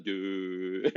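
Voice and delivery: sudden low, soft drop on Dude; voice dropping low. Shown as a demonstration. Showing the group his voice dropping, low and soft on Dude. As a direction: let Dude fall deep and quiet. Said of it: "and sometimes".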